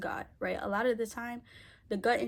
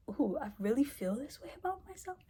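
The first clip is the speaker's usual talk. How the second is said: higher-pitched retold self-discovery; startled realisation; 6 semitones higher than usual. Woman recalls discovering how she feels about herself, startled realisation.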